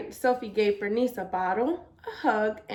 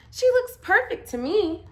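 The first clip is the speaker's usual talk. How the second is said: put-on higher, louder child's insistence; insistent; 8 semitones higher, 6 dB louder than usual. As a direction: stand up for her friend. Child defends squash to dad.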